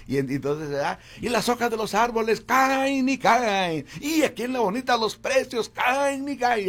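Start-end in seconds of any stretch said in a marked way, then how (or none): 1.2-6.7 high, loud radio ad pitch; ad announcer voice. Shown as a demonstration. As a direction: sell the chicken and shrimp like a hype announcer. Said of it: "ad slogan performed". (locutor de ofertas emocionado y fuerte)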